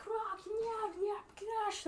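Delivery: high-pitched guttural-style gibberish; orc chatter. Acted. Orcs boast after the elves flee, high orc chatter. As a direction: jabber it high like a crowing orc. Voicing the orcs jeering in orcish.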